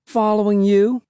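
put-on high-pitched, cheeky girl's retort; mocking retort. A narrator's reading. Cassie answers Will while making a face, high cheeky retort. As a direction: toss it back high and teasing. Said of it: "making a face at him".

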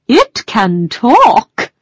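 slow, proud hare; lightly voiced helpful hare. A narrator's reading.

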